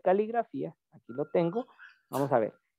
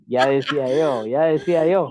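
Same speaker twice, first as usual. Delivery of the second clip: laughing; 6 dB louder than usual. Real, not acted. Teasing student after her joke.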